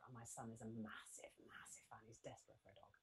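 hushed fond aside; whispered aside. Real, not acted. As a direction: let the secret slip quietly. Aside about son.

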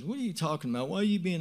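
high-pitched, imitated protest; retold indignant retort. She answers back in the argument, indignant. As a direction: throw back a baffled, high question.

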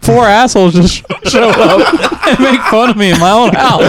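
whiny voice through laughter; laughing mock whine. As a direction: laugh while whining it. Voicing Ray's complaint, laughing.

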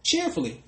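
"Cheerfully" repeated high and set apart; repeated for stress. Real, not acted. Preacher repeats the key word to the congregation, lifting "Cheerfully". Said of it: "take it cheerfully".

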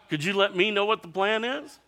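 high, loud exasperated question; exasperated ask. Retold or imitated. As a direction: demand the plan, fed up. Disciples pressing Jesus.

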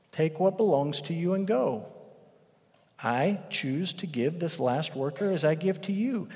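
soft, patient reply; gentle master. Acted. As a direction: calmly reassure the grumbling worker.

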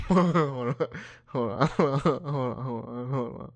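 high, loud, helpless laughing through the words; giggling. Real, not acted. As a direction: try to speak through a giggle fit. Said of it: "I got a case of the giggles".

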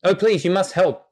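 a talking dog begging for help; pleading dog. Acted. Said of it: "puts his head down a little".

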